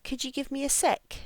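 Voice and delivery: "Could you" run into "couldja", "a" just "uh"; linked weak forms. Shown as a demonstration. Teacher reads for listening, "a" weakened to "uh". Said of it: "listen for how I say this".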